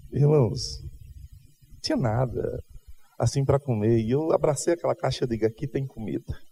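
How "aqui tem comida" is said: hushed delight. Retold or imitated. Hugging the food box.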